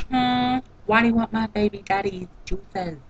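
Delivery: Emma impersonation. Retold or imitated. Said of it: "This is my Emma impersonation".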